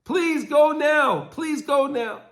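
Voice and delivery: retold frantic scream. Mother begging her friend on the phone, high frantic pleading. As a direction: beg like a mother afraid for her baby. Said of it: "She starts screaming".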